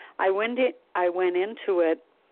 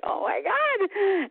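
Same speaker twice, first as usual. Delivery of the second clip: shocked oh my God; much slower than usual. Retold or imitated. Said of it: "It was like".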